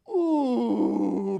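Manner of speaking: crowd ooh. Acted. Puppet crowd cry.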